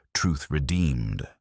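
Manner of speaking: ordinary delivery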